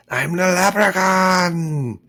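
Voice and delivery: high, slow, loud leprechaun shout. Pretend. Joking about Saint Patty's Day, a loud mock leprechaun cry. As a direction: yell it as a cartoon leprechaun. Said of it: "kinda try to talk like an Irish".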